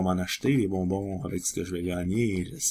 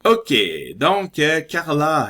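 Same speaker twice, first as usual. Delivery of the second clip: higher, louder eager start; excited; 7 semitones higher, 8 dB louder, slower than usual. Real, not acted. Starting the word Carla, eager and excited. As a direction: jump in hopefully. Hopeful about a new word.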